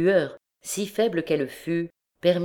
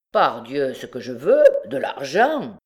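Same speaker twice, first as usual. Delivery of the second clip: brazen comeback; impudent retort; 5 dB louder than usual. A narrator's reading. Convict demands money of his victim, impudent retort. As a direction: toss it back with cheek. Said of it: "riposta impudemment le forçat".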